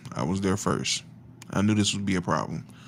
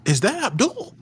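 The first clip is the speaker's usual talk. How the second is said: surprised; 11 semitones higher than usual. Retold or imitated. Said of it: "where I'm like".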